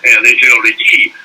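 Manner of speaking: imitation of mumbled Parisian French chatter; fast Parisian French. Shown as a demonstration. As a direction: rattle off French, swallowing the words. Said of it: "very fast French with swallowing a lot of words".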